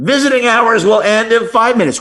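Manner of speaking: high, bad Brooklyn accent announcement. Hospital receptionist warning again, worst Brooklyn accent. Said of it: "Worst Brooklyn accent you can think of".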